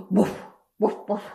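loud, short imitated barks; punchy playful woofs; dog barking. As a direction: bark like a dog. Dog sound opens the dog page.

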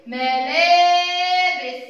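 ملابس very slow, the "laa" held loud and high; ملابس stretched on the alif; long vowel. Shown as a demonstration. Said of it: "صوت طويل".

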